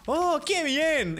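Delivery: high, drawn-out cartoon delight; badly imitated slow rejoicing; Homer. Player recites the Homer meme, high mock delight.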